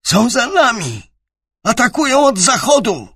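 high panicked shriek; hysterical scream. A narrator's reading. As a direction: scream it in terror. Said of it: "wrzasnął histerycznie".

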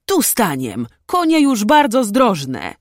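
put-on young nobleman calling out cheerfully; eager, slow-paced shout; joyful calling. A narrator's reading. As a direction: raise the voice high and loud as it goes on. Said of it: "krzyknął radośnie młodszy szlachcic".

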